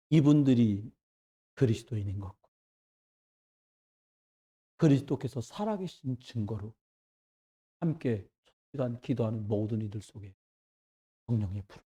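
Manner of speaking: very low, soft praying voice with long pauses; quiet prayer. Acted. Preacher models dawn prayer for the congregation, hushed low prayer. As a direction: pray hushed, phrase by phrase.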